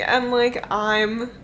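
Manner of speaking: ordinary delivery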